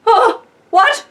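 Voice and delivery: high, loud, aghast yelp; scandalized squawk; mock shock. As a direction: react in exaggerated disbelief. Shocked reaction to retold remark.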